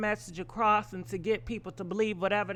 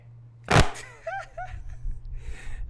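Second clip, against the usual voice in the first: high breathy laugh. Real, not acted. Laughs after the paycheck joke.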